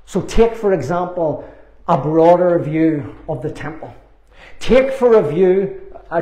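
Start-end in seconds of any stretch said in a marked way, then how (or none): none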